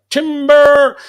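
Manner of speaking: long, drawn-out high holler; loud, stretched mock warning cry; lumberjack's timber call. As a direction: call it out like a tree is falling. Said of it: "this guy falls like a tree".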